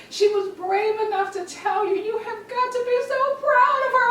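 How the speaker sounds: very high-pitched, emphatic gushing; imitated gushing praise. Girlfriend insisting the mother be proud, imitated high gushing. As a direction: gush it high as the proud friend.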